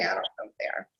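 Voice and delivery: a real whisper, voice dropped right off; whispered aside. Real, not acted. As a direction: breathe it to yourself as an unsure aside. Aside about scientific terms.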